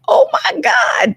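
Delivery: high, drawn-out panicked cry; re-enacted wild panic; wild freak-out. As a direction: wail it in mock horror, pitch shooting way up. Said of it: "I kinda went wild on it".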